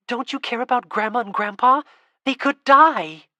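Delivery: desperate cry at someone who won't care; upset outburst. Acted. Girl pleads with indifferent Lena.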